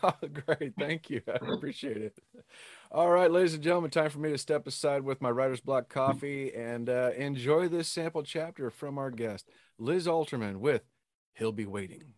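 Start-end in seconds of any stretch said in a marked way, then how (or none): 2.9-12.1 louder, upbeat host intro; show announcer. Acted. Podcast host introducing the guest's sample chapter, bright announcer voice.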